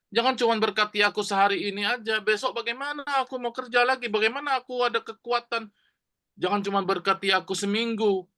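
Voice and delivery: high, pleading call to God; crying out to God. Acted. Sick Jabez cries out to God, high and pleading.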